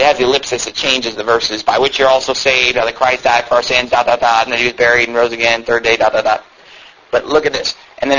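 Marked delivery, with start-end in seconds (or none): 1.6-6.4 low, level rattled-off reading; skimming recital. Real, not acted. Preacher skimming the tract's ellipsis-filled verses, perfunctory. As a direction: run through it in a monotone.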